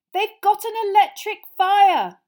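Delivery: high, loud, urgent explanation; calling through boards. Acted. Harry warning Mister Weasley fireplace is blocked, calling. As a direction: raise your voice to carry through.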